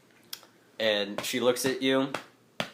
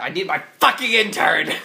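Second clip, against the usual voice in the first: higher-pitched irritated outburst; acted exasperation, pitched up; exasperated cook; 6 semitones higher than usual. Game master voices irritated cook.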